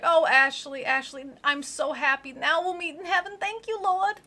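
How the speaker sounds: overjoyed pious grandmother, hammed-up; ecstatic grandma. Grandma rejoicing to Ashley at her bedside, hammed-up joy. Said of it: "then she's like".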